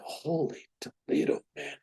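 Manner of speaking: slow, soft breathy whisper of disbelief; awed whisper. Retold or imitated. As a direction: breathe it out in stunned wonder. Stunned exclamation after ordeal.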